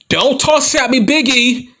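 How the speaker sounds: high, pushed yell; exasperated yell. Real, not acted. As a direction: heel promo outburst. Exasperated promo at fans.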